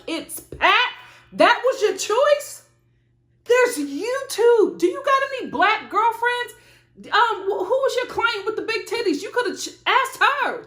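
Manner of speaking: outraged disbelief, voice climbing high; incredulous. Acted. Incredulous rant at the mother.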